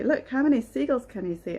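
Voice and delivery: usual speaking voice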